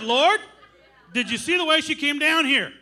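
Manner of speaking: loud, laughing protest to the Lord; laughing disbelief. Retold or imitated. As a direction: cry out in amused disbelief. Incredulous reply to God, laughing.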